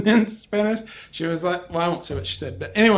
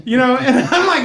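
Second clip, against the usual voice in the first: ordinary talk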